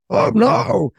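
high-pitched imitation of alarm; hammed-up cry of dismay; mock panic. Imitating people clinging to a collapsing structure, mock panic. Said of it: "holding on to it are going".

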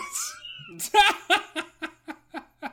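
very high squealing laugh; high-pitched laughing fit; bursting laugh. Real, not acted. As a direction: break into a long helpless cackle. Laughs after claiming not to laugh.

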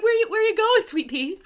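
put-on high, loud cooing to a toddler; playful baby talk. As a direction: tease a little one in a high, doting voice. Playfully addressing the dressed-up toddler.

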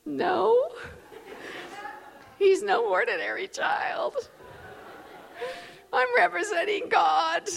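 high meek defence. Retold or imitated. New mother answering police who ask if she's lost, high and meek. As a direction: squeak out a hesitant excuse, with pauses.

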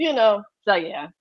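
ordinary talk, nothing marked

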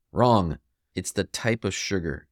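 ordinary talk

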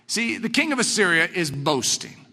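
normal talking voice

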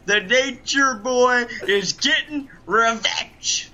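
loud, brash wrestler promo voice; boastful wrestling villain, shouting; Ric Flair impression. Plays the Nature Boy, Ric Flair.

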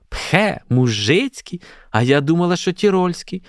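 high, let-down schoolgirl voice; disdainful surprise. A narrator's reading. Olha to mother, learning the hat is peasant, disdainful. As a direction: echo it as a scornful question. Daughter disdainfully echoes the correction.

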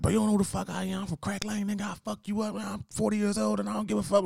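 mock threatening customer. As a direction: puff up like a street tough.

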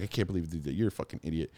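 low, hushed telling-off; scolding wife. Retold or imitated. Wife telling husband off after the cop, low and quiet. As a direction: scold under your breath, imitating an exasperated wife.

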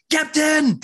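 high imitated shout; Chakotay yelling. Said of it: "But yelling".

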